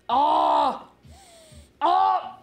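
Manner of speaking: loud, high 'oh' of pleasure; blissful exclamation. Real, not acted. Streamer smelling a freshly peeled orange, blissful.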